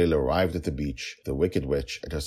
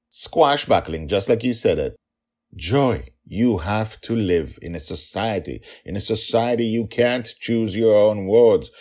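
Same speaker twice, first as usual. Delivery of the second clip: exasperated father; 7 semitones higher, 7 dB louder than usual. Acted. Exasperated father lectures daughter.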